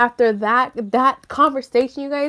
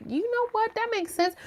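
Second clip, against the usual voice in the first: Eric agreeing; 12 semitones higher, 7 dB quieter than usual. Retold or imitated.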